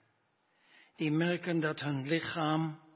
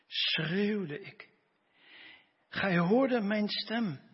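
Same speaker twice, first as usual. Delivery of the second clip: dramatic scripture reading. Acted. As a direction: proclaim the psalm with rising fervour. Dramatic reading of Jonah's prayer.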